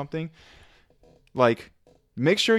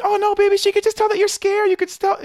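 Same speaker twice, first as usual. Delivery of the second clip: high-pitched, loud mock girlfriend voice; imitated girlfriend; 5 dB louder than usual. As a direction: squeal it out as the cooing girlfriend. Imitating girlfriend's coddling reassurance in story.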